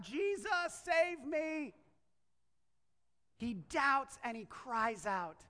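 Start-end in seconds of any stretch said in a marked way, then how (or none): 0.0-1.7 high-pitched cry for rescue; acted desperate plea, pitched up. Sinking disciple to his master, crying out. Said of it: "He cried out".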